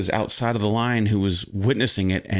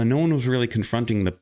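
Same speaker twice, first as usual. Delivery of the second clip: normal talking voice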